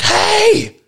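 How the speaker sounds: very high, loud mock scream; imitated scream. Woman suddenly screaming at people across the street, imitated scream. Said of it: "she just starts screaming".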